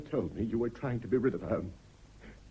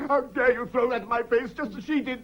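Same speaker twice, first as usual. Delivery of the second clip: outraged cry; 9 semitones higher than usual. Acted. Husband outraged.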